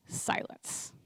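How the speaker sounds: breathy whispered "Silence."; a deadpan whispered beat. Retold or imitated. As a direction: whisper the word like an empty pause. No reply to texts again.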